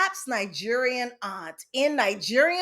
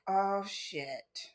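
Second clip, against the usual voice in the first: dreading under breath; 3 semitones lower, 9 dB quieter than usual. Retold or imitated. Aunt threatens to tell mother.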